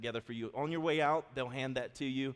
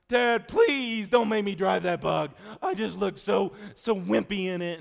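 put-on slow, high, loud pleading kid voice; whiny begging son; 6 semitones higher, 8 dB louder, slower than usual. Son begging dad not to drive the bug, slow whiny plea. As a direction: beg like a sulky teenager.

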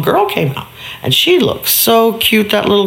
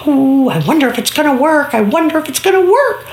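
put-on curious little girl; 7 semitones higher, faster than usual. Girl wonders to herself.

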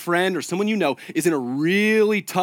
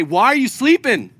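raised, pleading accusation, louder and higher; accusing plea; 6 semitones higher, 5 dB louder than usual. Retold or imitated. Believers accusing Jesus, pleading loudly. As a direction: demand answers like a frightened believer. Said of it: "point the finger at Jesus and say".

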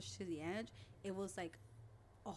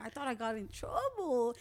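raised, higher retold alarm; shaken; 5 semitones higher, 7 dB louder than usual. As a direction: act out being rattled by a scare. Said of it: "He was shook".

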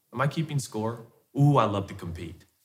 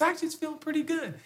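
much higher, softer and fast wife's reply; imitating wife; 6 dB quieter, faster than usual. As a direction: answer brightly and quickly like his wife. Wife's quote voiced in story.

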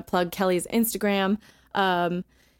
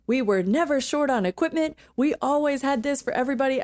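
higher-pitched mock denial; Trump; 6 semitones higher than usual. Quoting the president's denial to the co-host, mock insistent. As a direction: imitate a politician's insistent denial. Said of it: "but just him being like".

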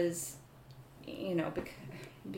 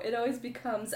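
ordinary talk, nothing marked

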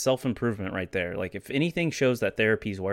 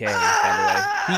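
laughing shout; 5 dB louder, much slower than usual. Real, not acted. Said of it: "I didn't say "mk".

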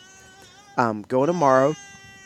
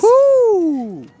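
high, loud whoop; cheering; 9 dB louder than usual. Real, not acted. Cheering the toddler.

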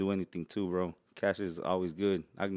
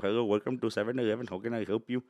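mock Indian shopkeeper accent; hammy Indian accent, customer-service greeting. Shown as a demonstration. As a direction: put on an Indian store-clerk accent. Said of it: "the Indian voice and be like".

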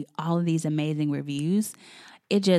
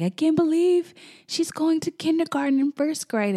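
tearful parent; 11 semitones higher than usual. Retold or imitated. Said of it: "and they would just cry".

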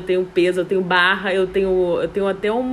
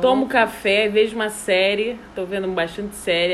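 ordinary talk

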